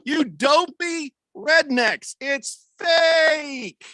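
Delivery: hammed-up taunting shout; mock shout. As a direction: yell it at the dopey fans. Mocking wrestling fans.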